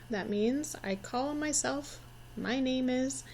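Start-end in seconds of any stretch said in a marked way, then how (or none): none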